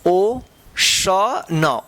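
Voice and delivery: ओशन very slow, broken into ओ, श, न; teacher sounding out ओशन slowly; syllable by syllable. Shown as a demonstration. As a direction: stretch out each part of ओशन with pauses. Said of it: "क्या होगा".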